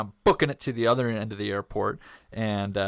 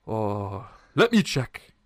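put-on Middle Eastern accent; 4 dB louder, slower than usual. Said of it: "I am gonna do the accent here".

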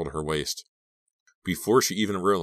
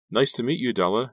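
higher-pitched, forced lightness; light, polite brush-off to get away; keeping it light; 7 semitones higher, 4 dB louder than usual. Acted. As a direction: try to sound casual and light. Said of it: "trying to keep it light".

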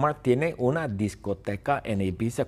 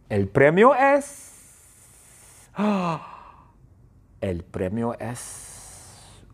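very slow, drawn-out teasing build-up; suspense; much slower than usual. Acted. Teacher teasing students about the prize, very slow suspense. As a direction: stretch it out to tease the reveal.

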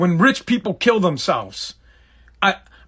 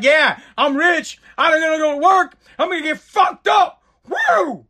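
excited party shout; 6 semitones higher than usual. Retold or imitated. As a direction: yell it like you're about to party. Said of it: "I'd be like".

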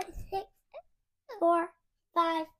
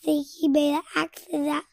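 plain reading voice

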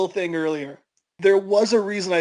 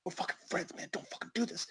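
urgent hushed pleading whisper; desperate whisper; 3 semitones lower, 19 dB quieter, faster than usual. Retold or imitated. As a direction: beg under your breath so mom can't hear. Homeless guy pleading behind Bobby.

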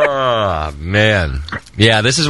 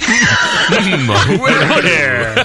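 high, drawn-out shouted hello; Mr. Brown voice; 7 semitones higher, much slower than usual. Acted. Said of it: "Daddy Bear's voice".

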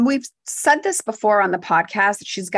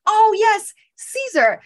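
gushing delight; 12 semitones higher, slower than usual. Retold or imitated. Friend greets the man she misnamed, high gushing delight. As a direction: gush over him, covering the wrong name. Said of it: "And she's like".

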